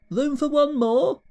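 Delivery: mock disbelief, rising high; incredulous echo. Echoes own words, incredulous.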